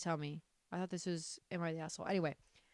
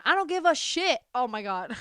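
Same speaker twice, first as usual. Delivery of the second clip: usual speaking voice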